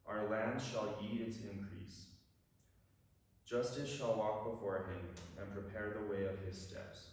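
ordinary talk, nothing marked

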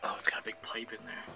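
hushed discovery whisper. Real, not acted. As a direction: breathe it out, unvoiced. Peeks at waterfall pump.

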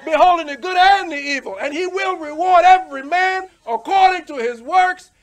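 preaching at full force. Acted. Preacher warning that God sees everything, loud. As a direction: thunder the verse at them.

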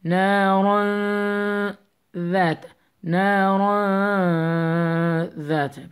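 نارا chanted with a long hummed nasal ending before ذات; held nasal sound. Shown as a demonstration. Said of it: "you read it exactly like that".

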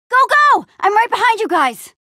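high, loud urging; urgent call mid-danger; urgent shout. Acted. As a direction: yell for friends to run. Urging friends to flee.

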